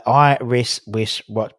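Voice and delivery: slow, separated "Irish wish watch"; broken-down syllables. Shown as a demonstration. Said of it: "Break it down".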